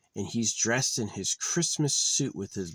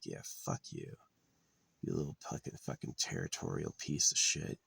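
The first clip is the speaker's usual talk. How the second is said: hissed under the breath, much softer; whispered insult; 5 semitones lower, 6 dB quieter than usual. Retold or imitated. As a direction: spit the insult in a low whisper. Imagined hostile male rival.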